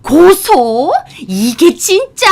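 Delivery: loud, high outraged questions; incensed disbelief. A narrator's reading. Mother-in-law hearing of lawsuit, loud incensed disbelief. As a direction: throw it back in loud outrage.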